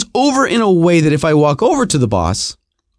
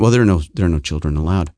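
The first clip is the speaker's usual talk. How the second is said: curt attendant voice; 12 semitones lower, faster than usual. Retold or imitated. Voicing the door attendant.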